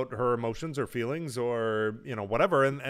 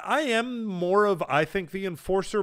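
usual speaking voice

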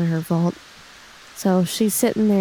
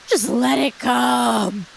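a touch of Thea's surrender; 4 semitones higher, slower than usual. Acted. Thea welcoming the rain.

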